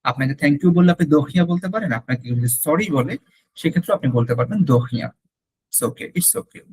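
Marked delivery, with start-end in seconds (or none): 5.7-6.6 soft reassuring whisper. Shown as a demonstration. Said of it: "acting a gentle reply".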